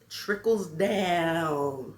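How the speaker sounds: very slow, stretched-out words; drawn-out emphasis. Real, not acted. Speaker showing stuff passed down through families, drawn out. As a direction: draw the vowels long.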